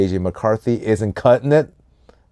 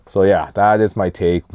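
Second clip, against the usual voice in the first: ordinary delivery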